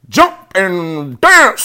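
high, loud, very slow rooster call; drawn-out acted bird cry. A narrator's reading. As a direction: stretch it out like a rooster. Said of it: "said the rooster".

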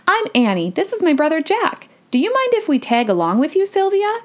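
put-on high child voice, rising to the question; eager young girl. A narrator's reading. Annie asks Sylvia to tag along, eager. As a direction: pipe up like an eager kid.

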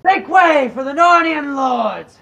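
loud, slow, high gruff battle shout. Shown as a demonstration. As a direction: bellow it like a herald clearing the road. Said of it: "I gotta say it in a gruff voice".

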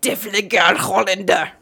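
loud, mock Dutch voice; Dutch accent. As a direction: belt out a hammed-up Dutch accent. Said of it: "get into my Dutch voice".

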